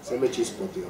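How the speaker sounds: a lightly voiced hushed catchphrase; Chavo del Ocho. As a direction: murmur it like the TV kid, soft and low. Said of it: "el Chavo del Ocho acostumbraba a decir". (imitación suave y baja del personaje)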